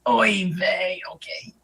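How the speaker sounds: put-on whispered yiddish accent; breathy whispered Yiddish-accented aside. Whispered mock exclamation.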